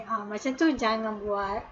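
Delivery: ordinary talk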